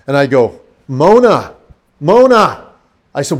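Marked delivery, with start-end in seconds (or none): 0.9-2.6 loud, higher-pitched name call; calling her awake. Retold or imitated. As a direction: call out to rouse a sleeper. Calling to wake comatose wife.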